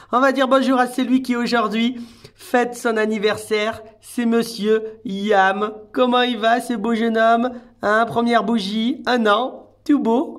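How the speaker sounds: high, loud, celebratory pet voice; excited birthday greeting. Real, not acted. Carer greeting Yam on his first birthday, high excited cooing. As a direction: announce him proudly and coo over him.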